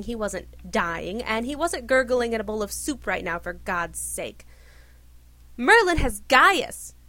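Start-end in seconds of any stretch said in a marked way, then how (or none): 5.6-7.0 loud, slow shout. A narrator's reading. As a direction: bellow it out in frustration. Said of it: "Arthur shouted".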